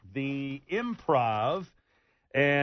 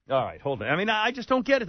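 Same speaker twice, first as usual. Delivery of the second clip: usual speaking voice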